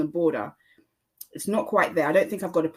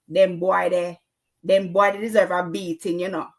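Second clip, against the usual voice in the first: louder, emphatic Jamaican patois accent; 2 semitones higher, 5 dB louder than usual. Shown as a demonstration. Podcaster showing where Jafaican comes from, Jamaican accent. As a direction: put on a punchy Jamaican accent. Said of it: "That's a Jamaican accent".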